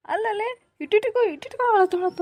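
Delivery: high, mocking tease about her crying; teasing. Friend mocks the crying girl Sahana, high teasing.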